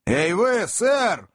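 high sharp call; shout. A narrator's reading. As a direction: call out a third time, insistent. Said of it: "крикнул Сэм в третий раз". (Сэм окликает в третий раз, высокий резкий крик)

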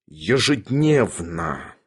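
low, very slow, measured word; stern menace. A narrator's reading. Bormental grips Sharikov by the lapel, stern menace. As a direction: spell out the warning low and slow. Bormental threatens, gripping his jacket.

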